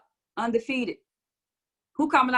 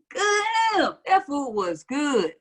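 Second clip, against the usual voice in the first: mock food rapture; 2 semitones higher, much slower than usual. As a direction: savor it slowly, hammed-up bliss. Acts out delight at great food.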